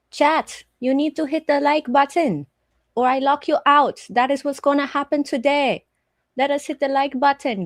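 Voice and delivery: ordinary delivery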